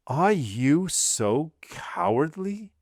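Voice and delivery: high, drawn-out scornful question; contemptuous. A narrator's reading. Husband exclaims at his cowering wife, contemptuous. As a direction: stretch each word in disdain, swooping upward. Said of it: "exclaimed Villefort contemptuously".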